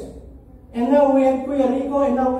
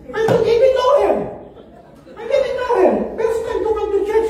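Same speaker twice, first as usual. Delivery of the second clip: higher, faster, outraged disbelief; 6 semitones higher, faster than usual. Real, not acted. Pastor recalling a newcomer's rude first question, incredulous. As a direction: say it like you still can't believe it.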